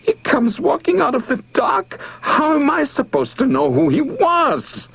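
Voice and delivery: very high, desperate tearful plea; crying out. A narrator's reading. Charlie begs the crowd to understand, high and tearful. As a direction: wail it in panic and tears. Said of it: "Tears rolled down his fat cheeks".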